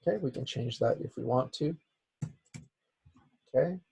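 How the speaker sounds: usual speaking voice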